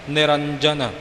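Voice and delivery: ਨਿਰੰਜਨ with a crisp ਜ; clear j in niranjan. Shown as a demonstration. Teacher repeating the clear ਨਿਰੰਜਨ. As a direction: say the ਜ in ਨਿਰੰਜਨ fully. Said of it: "ਜੱਜੇ ਨੂੰ ਸਪਸ਼ਟ ਬੋਲਣਾ".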